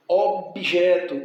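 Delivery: slow, firm "Objeto", broken after the B; split into syllables. Shown as a demonstration. Teacher showing B joins the earlier syllable, slow split "Objeto". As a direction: draw out "Objeto" as ob, jeto. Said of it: "o B não é seguido de vogal".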